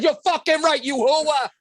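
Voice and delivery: loud, high gung-ho Pacino bark. Acted. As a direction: shout it like Al Pacino. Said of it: "Yo, hooah, hooah. Is Al Pacino here too?".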